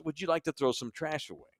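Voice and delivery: soft-spoken flight attendant politely asking. Retold or imitated. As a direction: quietly offer to take the trash. Flight attendant's polite question quoted.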